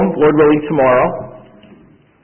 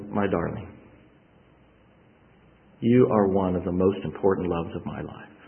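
tender; 5 semitones lower, 8 dB quieter than usual. Pretend. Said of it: "and I will say".

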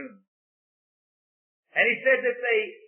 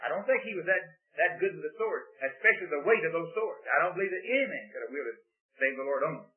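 normal talking voice

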